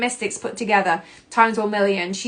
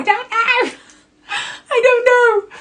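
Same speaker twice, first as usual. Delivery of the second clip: very high, loud, half-laughing wail; mock despair; 6 dB louder, slower than usual. Voicing the overwhelmed self, a high hammed-up wail. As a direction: whine it like you're overwhelmed. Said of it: "that energy of".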